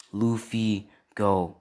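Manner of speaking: plain reading voice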